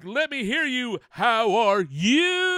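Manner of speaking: high, loud hype-man greeting, stretching the end; cheering on the listeners, high and loud; hype host intro. Acted. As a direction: pump up the crowd like a hype man. Hyped podcast host greeting.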